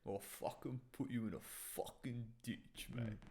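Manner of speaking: English accent demo. Shown as a demonstration. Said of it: "Any bloody English".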